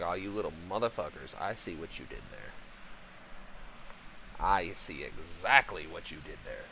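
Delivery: put-on low, drawn-back aside to the kids; knowing grudging admiration. Dad grudgingly sees kids' trick.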